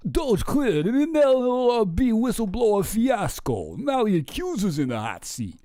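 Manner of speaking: a milder take on the hype voice; mock announcer, second try. Streamer retrying the tweet in a lighter mock announcer voice. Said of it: "Let's try again".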